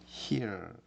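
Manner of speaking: hear drawn out slowly, like "hee-er"; demonstrated pronunciation. Shown as a demonstration. Teacher shows how hear is read, careful pronunciation. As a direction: model hear with its long ee glide. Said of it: "이렇게 읽고".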